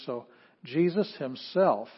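slow, himself lifted; slow stress on himself. Shown as a demonstration. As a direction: stretch and lean on himself. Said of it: "focus on".

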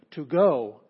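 "To go" with a loud punch on "go"; forceful stress on "To go"; emphatic. Shown as a demonstration. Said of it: "you want to make it emphatic".

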